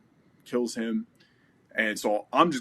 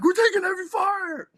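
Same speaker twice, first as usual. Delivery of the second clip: much higher and louder urgent yell; yelled radio call; 7 dB louder than usual. Retold or imitated. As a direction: shout it into the radio. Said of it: "I just yelled".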